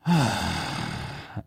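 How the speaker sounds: long exhale sigh. Retold or imitated. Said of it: "And I just went".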